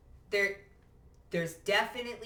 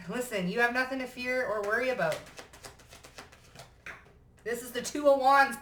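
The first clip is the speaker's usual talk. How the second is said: ordinary talk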